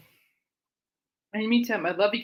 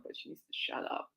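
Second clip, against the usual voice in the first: whisper; 14 dB quieter than usual. Real, not acted. Aside about noisy talker.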